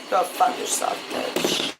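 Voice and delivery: breathy whispered curse; whispered insult. Real, not acted. Cursing a lady at Kidra, whispered under breath.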